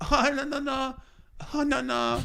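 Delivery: put-on high, soft old-lady denial; playing innocent, flustered. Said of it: "She goes".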